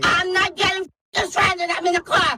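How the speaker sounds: angry yelling refusal; furious shout from the car. Real, not acted. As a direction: yell it, refusing to budge. Customer refusing to leave car.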